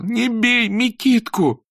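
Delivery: high, loud sobbing shout; snotty crying. Acted. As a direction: choke out a tearful plea. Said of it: "громко заплакал и, захлёбываясь от слёз".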